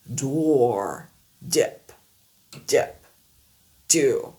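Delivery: each word slow with English D. Shown as a demonstration. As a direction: drill English D words one by one. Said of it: "pour vous apprendre à bien maîtriser".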